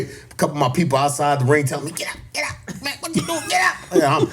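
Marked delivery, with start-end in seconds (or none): 1.9-3.9 high-pitched mock yell of get up; ringside yelling. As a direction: call him up off the mat, high and urgent.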